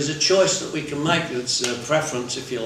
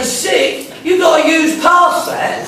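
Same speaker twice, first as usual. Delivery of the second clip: loud, high, laughing scoff; incredulous mock advice; 13 semitones higher, 9 dB louder, slower than usual. To his sibling who stops at feeling sick, in a loud high scoffing voice. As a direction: scoff at the weakness, grinning.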